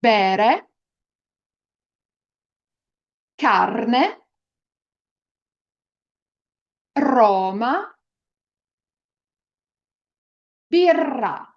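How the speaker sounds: Bere, carne, Roma, birra with a clear tapped r; each word said slowly for learners to repeat; Italian rolled r. Shown as a demonstration. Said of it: "Please repeat after me".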